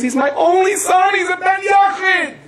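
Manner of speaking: very high, loud, slow crying shout; desperate plea. Retold or imitated. Father at the Ramah's feet, crying out for his son. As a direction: beg in anguish, stretching the words.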